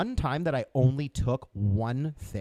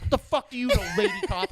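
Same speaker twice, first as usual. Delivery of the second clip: much higher, laughing, sneering challenge; mock a snotty fifteen-year-old; bratty teen sass; 10 semitones higher, 3 dB louder, faster than usual. Arrested teen sassing the lady cop, high and laughing. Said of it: "I'm like".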